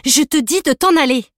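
high, loud, insistent command; angry shout. Acted. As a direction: make the dog obey. Girl keeps ordering the dog.

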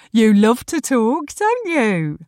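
high sing-along pet talk; doting dog owner. Acted. Woman fussing over her dog.

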